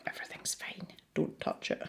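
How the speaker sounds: breathy whisper to herself, hands off. Real, not acted. Artist whispering to herself not to touch the wet paint, whispered. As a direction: whisper it like a secret warning to yourself.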